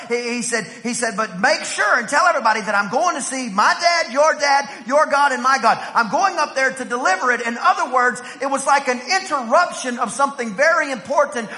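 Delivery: preaching full force, high and loud; rising sermon climax. Real, not acted. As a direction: build to a shouted sermon peak.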